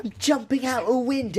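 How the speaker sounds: high hammed-up British voice; mock British accent. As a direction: copy the bad British accent back. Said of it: "echoing the British-accent quote".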